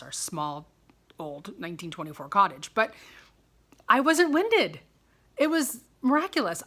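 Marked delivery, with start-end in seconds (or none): 3.9-6.6 emphatic. Real, not acted. Proud emphatic claim.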